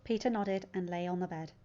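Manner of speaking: ordinary delivery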